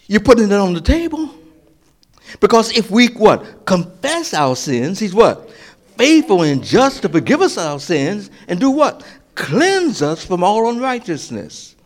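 high, loud call and response; preaching at full force. Acted. Preacher leading call and response on confession, loud preaching. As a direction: drive the verse home with the crowd.

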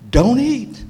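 short command, pitched high and set apart; voiced command. Preacher voices God's command to Adam, high firm order. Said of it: "That was a command".

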